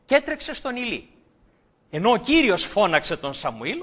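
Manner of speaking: ordinary talk, nothing marked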